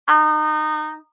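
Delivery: high level "Ah", held steady; "Ah" held high and level, no rise or fall; first tone. Shown as a demonstration. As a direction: sustain "Ah" on one high, even pitch. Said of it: "starts high and maintains the high pitch".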